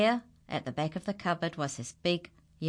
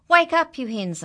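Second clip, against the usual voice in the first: much higher, loud call; joyful shout; 10 semitones higher, 11 dB louder than usual. Acted. Farmer shouting to wake the animals, joyful. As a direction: rouse the whole farm. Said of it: "he shouted".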